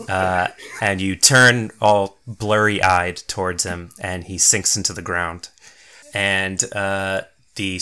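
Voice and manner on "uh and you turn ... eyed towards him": ordinary talk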